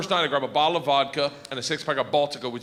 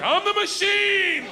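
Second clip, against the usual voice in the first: high, drawn-out yell; shouted boast; 5 dB louder, slower than usual. Retold or imitated. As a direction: belt it out proudly, stretching the last word. Repeats his boast to the gangster.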